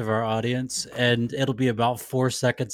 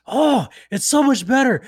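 excited bartender; 13 semitones higher, 7 dB louder than usual. Retold or imitated. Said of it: "He was like".

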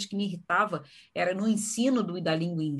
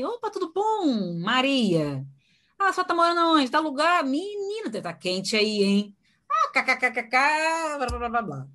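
much higher, relaxed chatty "Opa, tudo bom" with a laugh; casual friendly opening; 6 semitones higher than usual. Shown as a demonstration. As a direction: warm up the user like a buddy. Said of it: "Não, eu falo assim". (entrevistadora descontraída e animada, bem aguda)

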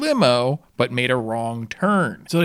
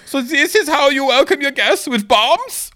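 high, loud mock Austrian archduke, indignant; Austrian-accented outraged archduke, pitched up and louder; 6 dB louder than usual. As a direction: sniff in a put-on Austrian accent, highly offended. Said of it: "in my best Austrian accent".

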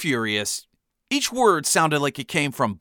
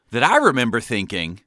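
ordinary delivery, nothing marked

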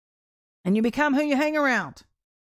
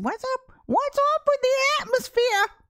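put-on high-pitched, agitated young barista; upset young lady; 11 semitones higher, slower than usual. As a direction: imitate her upset, voice pitched way up. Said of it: "all upset".

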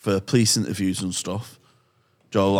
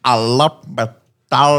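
very slow, loud put-on accent; hammed-up South African voice; mock South African impression; 5 dB louder, slower than usual. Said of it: "There's no logic to what he says".

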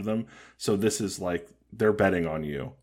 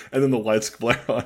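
normal talking voice

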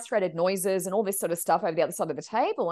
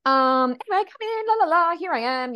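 much higher, forced bright chatter; awkward cheerfulness; 8 semitones higher, 6 dB louder than usual. Retold or imitated. Awkwardly ushering her in.

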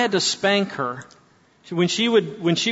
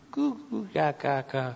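put-on soft cooing baby talk, much quieter; 9 dB quieter than usual. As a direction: coo gently to a baby. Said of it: "when a mama is feeding her baby".

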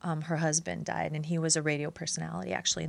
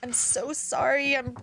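gentle condolence; 7 semitones higher than usual. Retold or imitated. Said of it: "So I text him, like".

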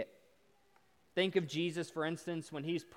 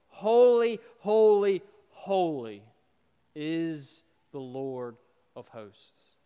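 calling out, fading; 10 dB louder, slower than usual. Acted. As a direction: start as a ringing call, settle into slow reverence. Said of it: "Back and forth, back and forth".

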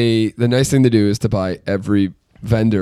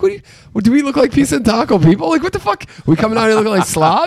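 high, shocked indignant rant; outraged disbelief; 11 semitones higher than usual. Retold or imitated. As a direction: act insulted by the suggestion, pitch soaring. Retelling outrage at the lady.

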